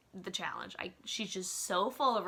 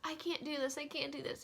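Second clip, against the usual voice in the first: mock whine; 7 semitones higher, 6 dB quieter than usual. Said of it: "when they're like".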